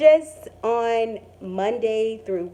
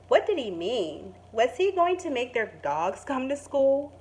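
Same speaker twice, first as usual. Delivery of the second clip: gentle storybook narration; calm picture-book reading voice; reading to children; 4 dB louder than usual. Acted. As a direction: read it like story time for kids. Teacher reads picture book aloud.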